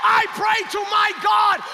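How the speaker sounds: very high, slow, ringing testimony; shouted preaching climax. Retold or imitated. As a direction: proclaim the healing at full force.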